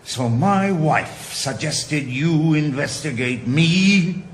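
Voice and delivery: drawn-out angry accusation; indignant. Acted. Father accusing the doctor, slow and indignant.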